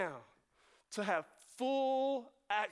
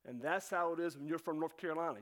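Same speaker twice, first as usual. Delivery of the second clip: usual speaking voice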